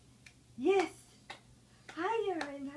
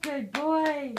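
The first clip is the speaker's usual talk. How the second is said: loud praise; 10 dB louder than usual. Real, not acted. Praising baby loudly.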